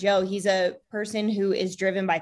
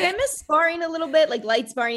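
a touch higher, lightly voiced husband; imitating husband; 8 semitones higher than usual. Wife quoting her husband about sparring, mildly higher. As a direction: play him mildly fond of sparring. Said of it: "And he's like".

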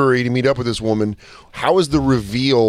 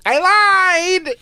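high mock confession. Mocking the girlfriend's confession.

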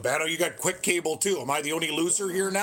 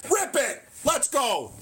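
real excited shouting; 10 semitones higher, slower than usual. Real, not acted. Fan yelling at the screen for a strip, excited shouting. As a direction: cheer the defense on at full voice. Fan cheering a defensive play.

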